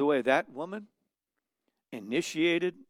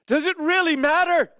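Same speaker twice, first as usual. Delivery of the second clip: emphatic rhetorical; 13 semitones higher, 9 dB louder than usual. Acted. Rhetorical question, loud high.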